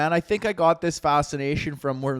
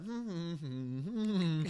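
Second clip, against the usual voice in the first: very soft little hums; imitated humming; 2 semitones higher, 14 dB quieter, slower than usual. Imitating the man on the subway, soft humming. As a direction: hum quietly, closed-mouthed, in short bursts.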